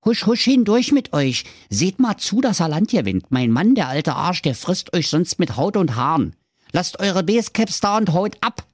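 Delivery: lightly voiced hushed helper; kind ogre wife. A narrator's reading. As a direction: shoo the kids away kindly. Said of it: "und sprach".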